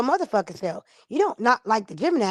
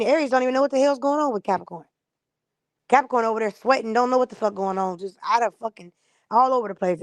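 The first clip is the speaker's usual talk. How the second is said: normal talking voice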